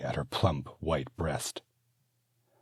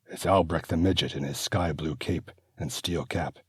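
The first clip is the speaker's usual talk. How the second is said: usual speaking voice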